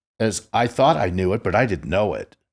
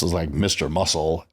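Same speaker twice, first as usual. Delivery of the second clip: ordinary delivery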